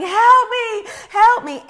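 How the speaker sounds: loud, high acted cry for help; imitated screaming. Imitating a naked woman running through the airport, screaming. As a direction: scream it like someone fleeing in panic. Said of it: "screaming and yelling and running".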